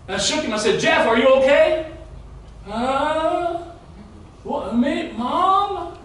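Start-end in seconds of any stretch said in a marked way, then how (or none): 0.8-1.9 raised, high-pitched worried check. Retold or imitated. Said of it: "your father with a high voice". 2.7-6.0 high, drawn-out waking-up mumble; groggy son. Retold or imitated. Groggy son being shaken awake.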